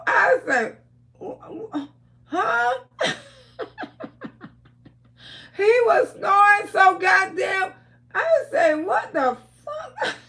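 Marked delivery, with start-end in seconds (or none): 1.2-2.7 high, faltering startled huh; startled stammer. Retold or imitated. Auntie retelling being jolted awake by his snoring, startled and stammering. 8.7-10.1 high, slow, laughing disbelief; mock disbelief. Startled by snoring.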